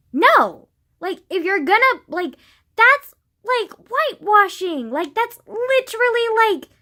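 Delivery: high, loud outraged outburst; furious rant. Real, not acted. Vlogger outraged at the casting choice, shouting high. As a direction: explode with outrage, pitch jumping up.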